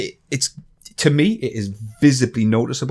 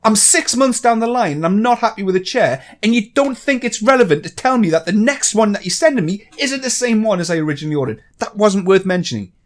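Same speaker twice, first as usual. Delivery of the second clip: higher, exasperated ranting; heated rant; 8 semitones higher, 3 dB louder than usual. Real, not acted. Vlogger venting at the chair company, heated raised rant. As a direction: vent the outrage in a raised voice.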